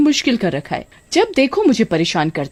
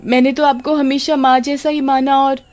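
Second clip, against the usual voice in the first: high, sugary, nervous denial; fake innocence; 6 semitones higher than usual. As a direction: sweet-talk to cover a lie. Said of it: "पोल खुल जाने के डर से". (पोल खुलने से डरी कामिनी, बनावटी मीठी सफ़ाई)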